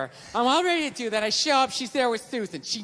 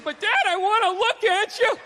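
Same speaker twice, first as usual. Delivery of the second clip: high, whiny little son; child's voice; 9 semitones higher than usual. Retold or imitated. A little son pleading with his dad, high whine. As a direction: plead like a small kid.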